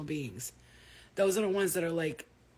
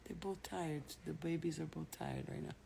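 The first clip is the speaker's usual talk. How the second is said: ordinary talk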